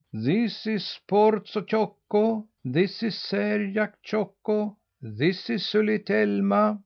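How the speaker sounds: high, slow calling out the peaks; calling out names. A narrator's reading. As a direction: call each peak name to the young. Old geese call out peaks.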